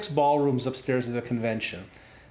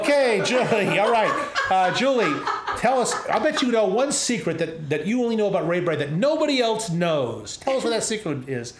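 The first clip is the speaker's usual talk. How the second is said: laughing exasperation; 6 semitones higher, 4 dB louder than usual. Retold or imitated. Exasperated host, laughing at himself.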